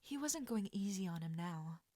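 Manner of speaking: usual speaking voice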